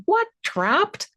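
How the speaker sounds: high, rising disbelief; startled what, trapped; incredulous. Retold or imitated. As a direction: shoot it up in shock. Incredulous reply to ghost claim.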